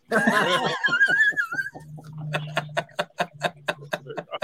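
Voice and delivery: long high-pitched burst of laughter; big high laugh. Real, not acted. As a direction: laugh hard and high at the punchline. Laughing at own story.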